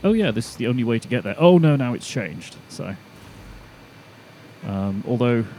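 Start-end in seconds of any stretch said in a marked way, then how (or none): 0.0-2.6 hurried, louder voicing of a rerouting train; confused train. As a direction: act out a sudden change of mind. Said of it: "it might end up going like".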